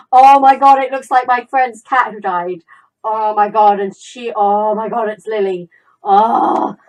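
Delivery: loud, gushing recognition; astonished outcry. Real, not acted. Gamer seeing her friend's dead cat lookalike, astonished outcry. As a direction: exclaim loudly in shocked recognition.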